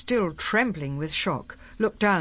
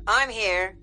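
higher-pitched curt bark; 6 semitones higher than usual. A narrator's reading. General confirming he is on the line, barking. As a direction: bark out your presence. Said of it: "barked Cutler".

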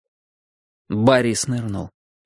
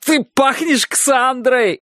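laughing; 12 semitones higher, much slower than usual. Acted. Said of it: "Я хохотал так, что не мог и слова выговорить".

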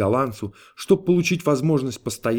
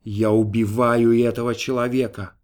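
usual speaking voice

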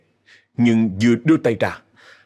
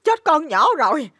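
very high, wailing lament; tearful, high-pitched scolding; crying and shouting. Acted. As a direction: wail despairingly at your son. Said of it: "vừa khóc vừa la".

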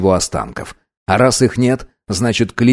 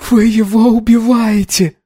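very high, shrill accusing cry; furious scream; 13 semitones higher, slower than usual. Acted. Anna accusing the crew of killing her brother, high screaming. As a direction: hurl it at them all.

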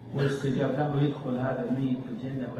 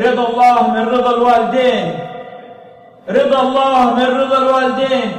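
loud, high preaching at full force; fiery preaching; 11 semitones higher, 11 dB louder than usual. Acted. Preacher warning the congregation about parents, full-force sermon. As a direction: hammer the lesson home to the crowd.

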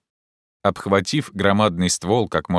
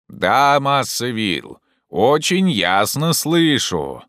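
slow, higher, stretched reply; calling down; 6 semitones higher, 3 dB louder, much slower than usual. Acted. Jupiter tells master he hears, calling down. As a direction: holler the answer back. Climber answers from the tree.

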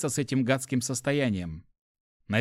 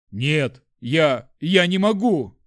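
loud, faltering panicked refusal; horrified gasp; 3 semitones higher, 7 dB louder, much slower than usual. A narrator's reading. Lad stares at spiders in horror, horrified gasp. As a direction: stammer out a terrified refusal. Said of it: "выдохнул Жюль, с ужасом глазея".

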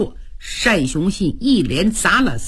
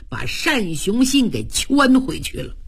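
ordinary delivery

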